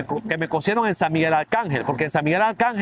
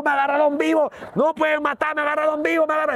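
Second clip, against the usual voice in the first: much higher pleading cry, reenacted; retold loud shouting; 9 semitones higher than usual. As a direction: cry out so the neighbors hear. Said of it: "Empecé a gritar duro".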